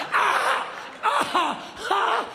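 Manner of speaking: high panicked ahs; mock panicked cries. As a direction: cry out lost in the dark. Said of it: "I'm like".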